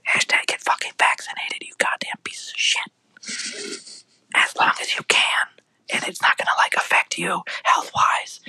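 hushed mock-confidential plea; whispered aside. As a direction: whisper a secret public service announcement. Whispered aside urging listeners to vaccinate.

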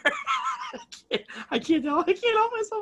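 can't stop laughing while saying sorry. Real, not acted. Apologising to his friend through high giggles.

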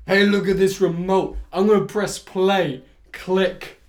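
loud over-explaining action hero; mock commentator voice. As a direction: announce each step with mock importance. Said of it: "He just commentates everything".

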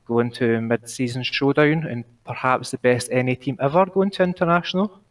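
usual speaking voice

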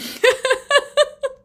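high, loud burst of laughter; squealing giggle; high-pitched laugh. Real, not acted. Breakdown laughing.

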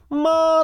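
まあ high and level; first tone. Shown as a demonstration. Teacher introducing the word for mother, high level まあ. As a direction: sustain まあ on a high note. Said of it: "これ第一声なんですけども".

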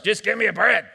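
hammed-up bread craving; mock hungry demand. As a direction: demand it like a comically desperate eater. Acts out hungry person demanding lunch.

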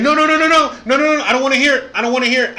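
mock someone plugging his ears; mock refusal. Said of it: "just like the fucking N P C guy ... Like".

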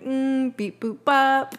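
mock robot placeholder noise; toy robot voice. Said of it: "It's, like, robotic".

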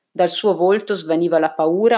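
ordinary delivery, nothing marked

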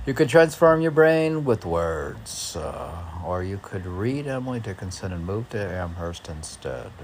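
loud, lifted recital; declaiming a poem line; poem reading. Acted. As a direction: read it out as verse. Reads poem lines aloud.

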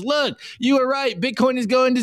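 fake crying Bitcoiner. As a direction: whimper it in mock defeat, playing the victim. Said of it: "And he goes".

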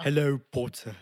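lightly voiced sneering schoolboy; mock British sneer; Draco Malfoy. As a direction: lean on hello with contempt. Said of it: "how does Draco Malfoy say it".

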